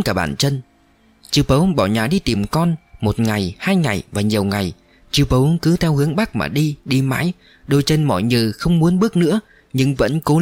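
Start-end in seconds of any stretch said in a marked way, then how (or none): none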